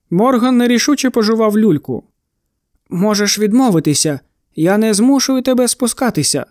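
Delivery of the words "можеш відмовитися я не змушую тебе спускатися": plain reading voice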